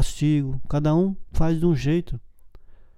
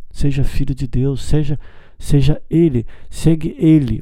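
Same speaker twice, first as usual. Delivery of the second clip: normal talking voice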